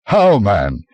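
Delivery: mild irritated outburst; irritable exclamation. A narrator's reading. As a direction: snap it out, annoyed. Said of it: "he exclaimed irritably".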